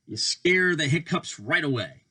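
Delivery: usual speaking voice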